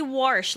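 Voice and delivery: "warsh" with an R put into "wash"; dialect "warsh". Retold or imitated. Reading a viewer's question about grandparents, dialect "warsh" with an added R. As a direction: say "warsh" the old country way. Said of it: "like my grandparents".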